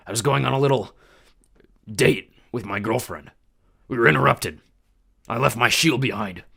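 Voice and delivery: loud swaggering biker god; brash god of war. A narrator's reading. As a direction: taunt the kid with easy menace. Ares voiced through dialogue.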